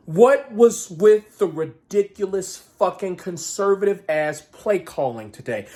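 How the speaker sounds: normal talking voice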